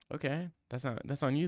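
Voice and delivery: usual speaking voice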